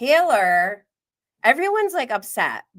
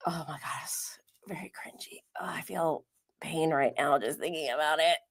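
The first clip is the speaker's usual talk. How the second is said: hushed, pained whisper; cringing whisper; 2 semitones lower, 9 dB quieter than usual. Real, not acted. Cringing at the memory.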